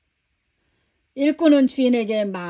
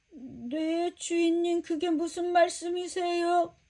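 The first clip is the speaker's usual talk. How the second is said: much softer, timid and feigning innocence; cowering servant; 5 semitones higher, 8 dB quieter, slower than usual. Acted. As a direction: shrink back, playing dumb. Servant cowers before angry master.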